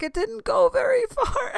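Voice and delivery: very high squeal breaking into laughter; laughing echo. Retold or imitated. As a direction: repeat it back in a squeaky, laughing voice. Gleefully repeating his quip back.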